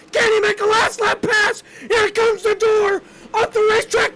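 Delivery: frantic last-lap race call; excited shouting. Real, not acted. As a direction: yell the finish at full voice. Final lap play-by-play, shouting.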